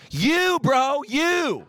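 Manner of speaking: loud, high, drawn-out exclamation; emphatic call-out. Acted. Preacher calling out the big guy in the corner, loud holler. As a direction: holler it high, stretching each "you".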